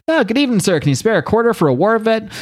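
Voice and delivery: high-pitched imitation panhandler; begging homeless man. Homeless man asks a stranger for change, begging. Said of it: "he's tr-, asking for change".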